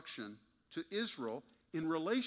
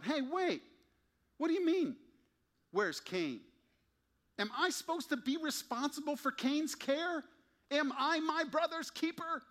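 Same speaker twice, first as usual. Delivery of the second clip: much higher, louder sneering protest; cynical; 14 semitones higher, 5 dB louder than usual. Retold or imitated. Cain dodging God, high cynical protest. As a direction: throw the question back at God. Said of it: "Cynically, Cain asked God".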